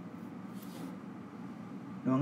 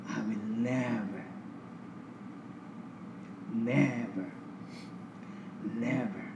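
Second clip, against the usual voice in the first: soft reverent; 3 semitones lower, 8 dB quieter, slower than usual. Real, not acted. Soft close of promise.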